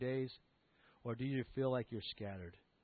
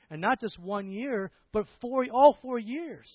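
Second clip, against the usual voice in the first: slow, then louder and louder on four years; building preacher's climax; 8 semitones higher, 10 dB louder, slower than usual. Acted. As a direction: build each phrase up to a ringing all four years. College gift, preaching climax.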